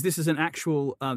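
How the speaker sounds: ordinary talk, nothing marked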